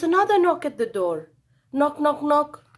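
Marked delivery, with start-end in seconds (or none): 1.7-2.6 knocking. A narrator's reading. Sound effect read.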